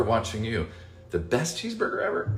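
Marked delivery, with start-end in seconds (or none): 1.1-2.3 cheeseburger pitched much higher, rising in disbelief; incredulous echo. Shown as a demonstration. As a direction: repeat it like you can't believe the claim.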